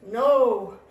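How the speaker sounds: loud, grumpy refusal; grumbling. A narrator's reading. Tortoise refusing Robin's invitation, grumbling. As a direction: grumble it out with force. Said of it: "grumbled Tortoise".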